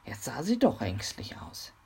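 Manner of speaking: plain reading voice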